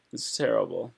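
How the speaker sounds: ordinary talk, nothing marked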